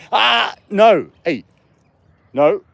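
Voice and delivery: loud, high cranky scold. Real, not acted. Owner telling his dog off, loud cranky voice. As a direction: snap a warning at the dog. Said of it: "That's my cranky voice".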